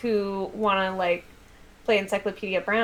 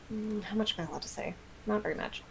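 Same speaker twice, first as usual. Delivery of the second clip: ordinary talk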